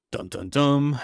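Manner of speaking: hammed-up cliffhanger sting; mock suspense sting. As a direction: voice a playful dramatic plot-twist sting. Mock suspense sting at plot twist.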